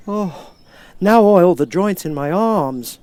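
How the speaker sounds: a rusted man grateful to be heard; Tin Man. Acted.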